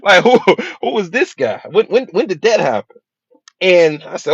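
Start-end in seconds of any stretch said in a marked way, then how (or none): 0.0-3.0 high, loud laughing disbelief. Retold or imitated. Man laughing in disbelief at his own reflection. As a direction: laugh it off, still stunned.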